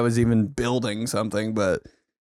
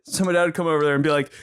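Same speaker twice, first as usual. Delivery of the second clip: ordinary talk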